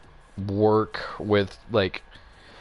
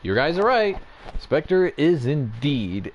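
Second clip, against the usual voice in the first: imagined developers' announcement, higher and louder; raised-voice mock official confirmation; game producers confirming; 6 semitones higher, 4 dB louder, slower than usual. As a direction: play producers grandly confirming a fan theory, drawn out at the end. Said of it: "the game producers would have been like".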